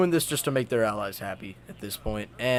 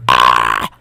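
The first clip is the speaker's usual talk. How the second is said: a sudden, much lower and louder 'Ah!'; startled shout; 12 dB louder than usual. Retold or imitated. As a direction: blurt out a low, loud cry as if caught off guard. Germany reacts to the ultimatum.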